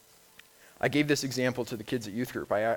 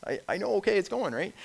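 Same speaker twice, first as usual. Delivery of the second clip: exasperated reply; 9 semitones higher than usual. Retold or imitated. Answering yelling boss on radio.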